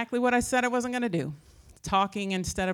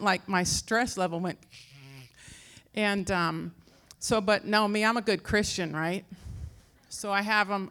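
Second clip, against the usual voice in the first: ordinary delivery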